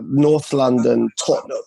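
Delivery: Tottenham spat out as TOT-num, thick; thick North London. Shown as a demonstration. Fan shows host how locals spit out Tottenham. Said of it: "kind of spit it out".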